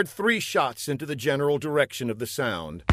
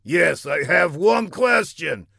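raised, louder villain voice; indignant villain; 6 semitones higher, 5 dB louder than usual. A narrator's reading. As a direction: confront the scientist sternly. Villain confronts scientist.

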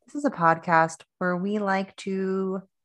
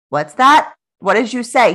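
raised, high-pitched questioning; loud mock asking; 6 semitones higher, 9 dB louder than usual. Wife straining to hear husband, loud hammed-up questioning. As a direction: call out as if unable to hear.

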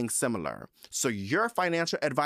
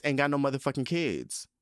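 usual speaking voice

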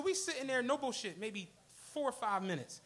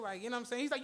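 usual speaking voice

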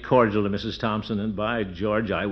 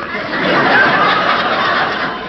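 long breathy chortle; Gildy's trademark giggle at his own joke; signature giggle; 8 dB louder than usual. Acted. Pompous man chuckling at his own quip to the judge.

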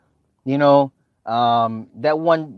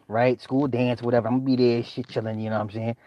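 ordinary talk, nothing marked

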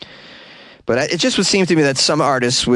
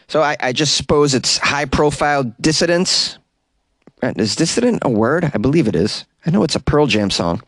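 ordinary delivery

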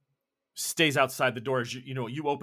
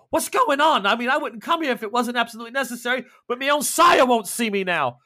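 higher, louder, rushing panic; panicked; 9 semitones higher, 6 dB louder than usual. Acted. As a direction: plead it in rising panic. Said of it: "Calm down".

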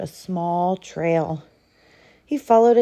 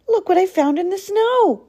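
high, loud announcement; urgent, high-pitched call; excited cry; 12 semitones higher, 5 dB louder than usual. A narrator's reading. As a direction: cry it out, bursting in with news. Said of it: "cried Fox".

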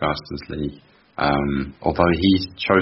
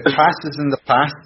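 a stab at a Scouse accent, a little louder; Scouse accent attempt; 9 semitones higher, 4 dB louder than usual. Shown as a demonstration. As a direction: try the Scouse accent on this sentence. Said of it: "have a stab at these three sentences".

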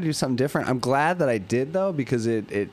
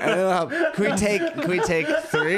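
laughing; 7 semitones higher than usual. Real, not acted. Laughing while asking for retake.

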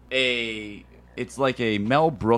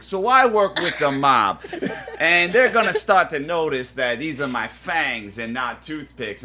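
Italian mobster vampire; 2 semitones higher than usual. Retold or imitated. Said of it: "let's hear the Italian vampire".

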